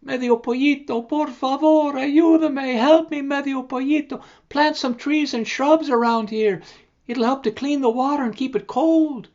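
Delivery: high pleading creek. Acted. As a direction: beg for help as the creek. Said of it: "And the creek said".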